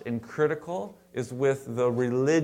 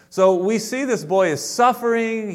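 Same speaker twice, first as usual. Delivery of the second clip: emphatic preaching; 9 semitones higher, 7 dB louder than usual. Real, not acted. Preacher stressing the boy's suffering.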